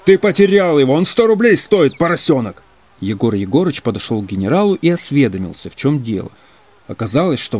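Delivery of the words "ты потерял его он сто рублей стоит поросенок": angry, high-pitched loud outburst; thunderous general's voice. A narrator's reading. General scolding Ваня over a lost item, thundering. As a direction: thunder at the culprit. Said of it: "раздался громовый голос генерала".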